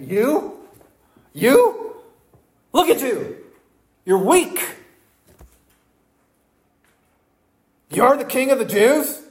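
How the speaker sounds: high, scornful mock of Pilate; mocking disbelief. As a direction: sneer it at a beaten prisoner. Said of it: "a question of mockery and disbelief".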